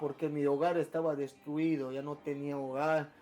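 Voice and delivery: low, soft and heavy with regret; subdued sorrow. Real, not acted. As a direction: let the voice sink as you confess the loss. Cuenta su hogar destruido. (con pesar, en voz baja)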